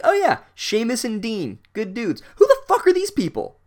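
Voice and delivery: high, loud sarcastic recognition bursting into bafflement; mock familiarity turning exasperated. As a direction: feign fond recognition, then explode in high disbelief. Mocks book's assumed familiarity, then exasperated.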